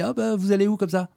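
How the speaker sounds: a hint of a curious local man asking; voicing the stranger.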